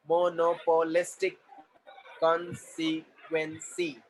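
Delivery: very slow, separated syllables. Shown as a demonstration. Teacher modelling syllables for the class, syllable by syllable. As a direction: break the word into slow beats.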